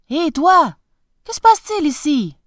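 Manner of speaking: called out loud and high, quick; calling out. A narrator's reading. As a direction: hail the sailor across the quay. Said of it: "le prince appela un marin". (appel lancé fort au marin)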